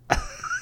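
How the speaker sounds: high, helpless laughter; real laughing fit. Real, not acted. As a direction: break up into a long laughing fit. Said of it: "I'm laughing because".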